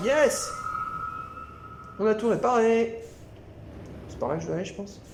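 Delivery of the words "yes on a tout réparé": happy cheer. Real, not acted. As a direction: celebrate the finished repair. Repair done, cheering. (cri de victoire aigu et fort)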